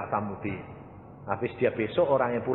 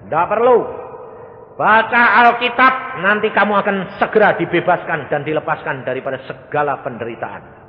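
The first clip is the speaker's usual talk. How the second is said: firm instruction; 5 semitones higher, 10 dB louder than usual. Retold or imitated. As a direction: tell her firmly what to do. Pastor commanding the woman. (perintah tegas dan lantang)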